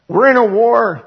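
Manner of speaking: rally the room: we're in a war; preacher shouting a battle cry; loud declaration. Real, not acted.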